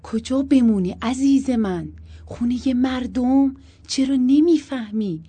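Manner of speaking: loud frustrated questioning; loud, half-swallowed voice. A narrator's reading. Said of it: "با صدای بلند نیم خورده گفت".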